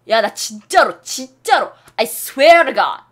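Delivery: loud, insistent oath; emphatic swear. Shown as a demonstration. 친구에게 진짜라고 우기며 큰 소리로 맹세. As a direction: swear it loudly like you mean it. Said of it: "이렇게 얘기해요".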